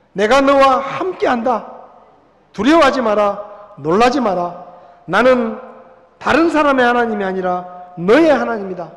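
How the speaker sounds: loud, ringing preacher voice; preaching at full force. Acted. Pastor to his congregation, loud fervent preaching. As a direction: proclaim it to the whole hall.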